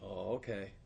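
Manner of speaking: soft, low, earnest acceptance; dead serious. Retold or imitated. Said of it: "like, real serious. He wasn't laughing".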